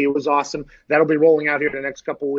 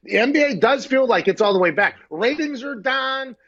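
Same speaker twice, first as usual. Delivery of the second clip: dry sarcasm, pitched up; deadpan mock praise, voice climbing; sarcastic; 7 semitones higher, 3 dB louder than usual. Real, not acted. Sarcastic NBA joke.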